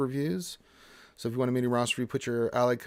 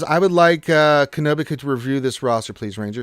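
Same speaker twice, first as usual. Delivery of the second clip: loud quote; 3 semitones higher, 10 dB louder than usual. Real, not acted. Reading a viewer's request.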